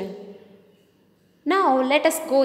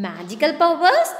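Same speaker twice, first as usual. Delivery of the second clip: surprised; 3 semitones higher, 4 dB louder than usual. A narrator's reading. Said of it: "the children asked in surprise".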